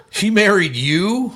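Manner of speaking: incredulous dinner guest. Retold or imitated. Dinner guest meeting the host's wife, slow amazed disbelief. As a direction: stretch it out, jaw dropped at the news. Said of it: "And he goes".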